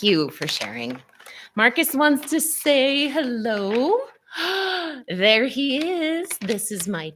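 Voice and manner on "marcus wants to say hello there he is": pet talk. Real, not acted. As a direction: coo over the dog like a delighted owner. Shows her dog on camera.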